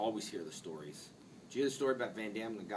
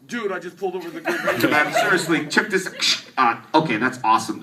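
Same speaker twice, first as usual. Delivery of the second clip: louder, higher, gushing into the radio; thrilled to have pulled over a ranger; excited cop on radio; 7 semitones higher, 18 dB louder than usual. Retold or imitated. Cop radios his buddy, loud and excited. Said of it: "he kinda got a little excited".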